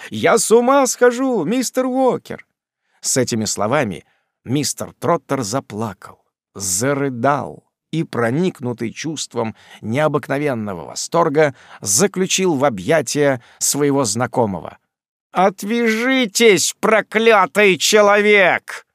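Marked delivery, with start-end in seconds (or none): 0.1-2.5 overwrought joy. A narrator's reading. Job overplays joy. 15.3-18.9 high, slow, loud shove-off; irritated cry. A narrator's reading. Sam, annoyed, fights off Job's embrace with a loud slow cry. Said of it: "вскричал раздражённый Самуэль".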